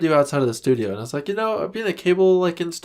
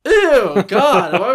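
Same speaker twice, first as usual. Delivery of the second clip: disgusted ew; 9 semitones higher, 7 dB louder, much slower than usual. Real, not acted. Reacting to Daily Motion suggestion.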